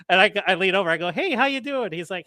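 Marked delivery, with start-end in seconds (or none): 1.1-1.9 high, bright service-voice hello; mock-cheerful assistant manager greeting; cheery manager greeting. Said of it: "I'm acting like an assistant manager".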